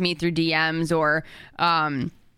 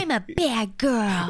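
Legally Blonde impression; slower than usual. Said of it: "I'm trying to do that impression".

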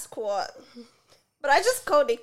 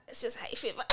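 breathy unvoiced whisper; 10 dB quieter than usual. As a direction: whisper it, half laughing. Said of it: "And I was like".

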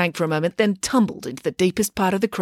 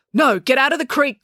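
raised, higher warning; urgent, serious shout; urgent call; 6 semitones higher, 4 dB louder than usual. A narrator's reading. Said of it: "called Loretta".

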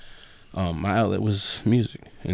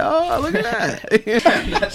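laughing; 4 dB louder, much slower than usual. Retold or imitated. Amazed quote while laughing.

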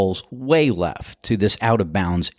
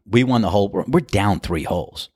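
ordinary talk, nothing marked

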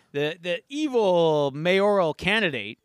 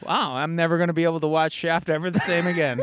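ordinary delivery

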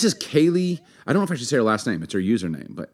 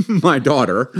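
laughing through the correction, louder; 4 semitones higher, 6 dB louder, slower than usual. Real, not acted. Husband correcting himself, laughing at the slip. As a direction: laugh at his own mix-up.

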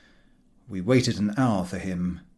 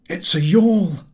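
surprised sailor; 7 semitones higher, 7 dB louder than usual. A narrator's reading. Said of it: "He seemed surprised".

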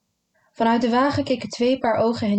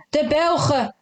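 higher-pitched outburst; mild exclamation; 6 semitones higher than usual. A narrator's reading. Narrator blurting who split the village, mild exclamation. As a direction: call it out, pitched up.

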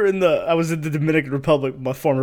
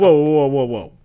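loud protest; 7 dB louder than usual. Real, not acted. Protesting being handed the task.

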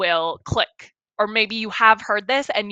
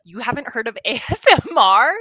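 louder, rising disbelief with a laugh; dumbfounded; 5 dB louder than usual. Retold or imitated. Wife retelling her reaction to her husband, dumbfounded. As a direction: re-enact being dumbfounded, voice climbing at the end.

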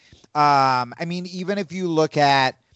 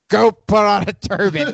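imitating a bossy order, higher and louder; mock boss order; 6 semitones higher, 5 dB louder than usual. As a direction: bark it like the boss, lightly. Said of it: "Vince McMahon's like".

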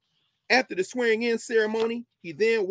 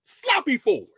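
name spat out higher and louder in scorn; mocking repeat; 6 semitones higher, 6 dB louder, slower than usual. Lecturer mocking the segregationist legislator's name, scornful. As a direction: sneer the name, pitched up and loud.

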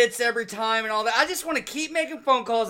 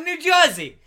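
put-on Boston accent; 7 semitones higher, 4 dB louder than usual. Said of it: "mostly a Boston accent".

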